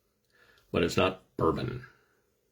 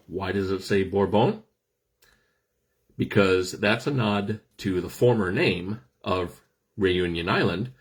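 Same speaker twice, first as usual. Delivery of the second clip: normal talking voice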